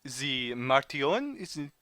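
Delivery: hammed-up French accent with lingering words. Informant checking what the travellers seek, French accent. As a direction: question them in a French accent. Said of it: "your French accent".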